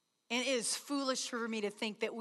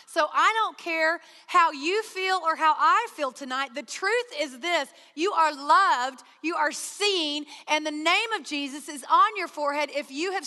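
louder, emphatic, building proclamation; full-force preaching; 4 semitones higher, 6 dB louder than usual. Acted. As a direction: declare it to the room with conviction.

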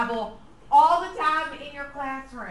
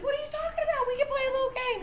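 mock-innocent, much higher; innocent mom voice; 14 semitones higher, much faster than usual. Mother to son, feigning innocence in a high mock-innocent voice. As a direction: play the innocent mother, pitched way up. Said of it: "And I said".

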